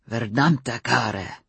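slow, low growl of a curse. A narrator's reading. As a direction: growl it out slowly in frustration. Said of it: "Z growled".

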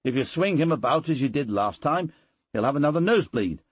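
worried warning. A narrator's reading. Tutor warns uncle about swinging the boy, quick worried cry. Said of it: "cried the tutor".

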